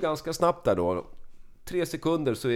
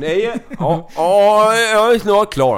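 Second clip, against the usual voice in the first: loud, high, drawn-out calls; calling out; 8 semitones higher, 7 dB louder, much slower than usual. Retold or imitated. Storyteller acting the toilet exchange with his friend, loud stretched shouts. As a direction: holler as if through a toilet door.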